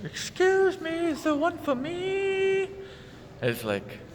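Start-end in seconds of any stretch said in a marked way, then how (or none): 0.0-2.7 slow, very high falsetto plea; mock him with a stretched, squeaky voice; roommate impression. Roommate asking for a coat too, mocking falsetto. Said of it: "Then I hear".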